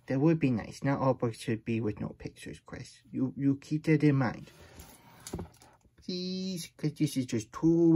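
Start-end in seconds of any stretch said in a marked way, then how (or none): none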